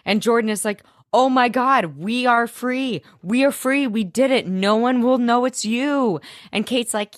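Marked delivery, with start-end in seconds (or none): 1.1-6.2 hammed-up triumphant relief; acted elated relief. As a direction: proclaim freedom like a melodramatic TV heroine. Acted character celebrating being freed.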